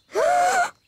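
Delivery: sharp high gasp of shock. Acted. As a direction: gasp in horror. Nanny hears queen's teapot broken.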